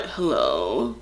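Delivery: low, soft, drowsy hello; fake sleepy hello. Answering the boyfriend's call, faking sleepy voice. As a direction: pretend you just woke up. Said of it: "Like I'm sleeping".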